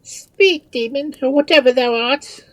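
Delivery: high, loud challenge; shrill alarmed command; crying out. A narrator's reading. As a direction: cry out a demand to a demon. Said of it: "cried Marianne".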